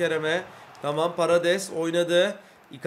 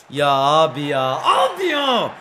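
exasperated yell; 3 semitones lower, 6 dB louder, slower than usual. Real, not acted. Gamer exasperated at conceding, groaning into a shout.

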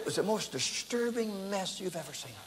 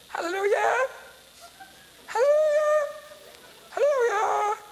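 very high, loud ecstatic cries of hallelujah; woman shouting hallelujah; 5 dB louder than usual. Retold or imitated. Woman on the front seat crying out, high and loud. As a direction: mimic a frenzied churchgoer calling out high and loud. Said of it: "she began to say".

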